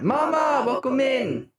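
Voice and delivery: high, sung-out greeting call; excited welcome. Real, not acted. Calling out greeting to viewer.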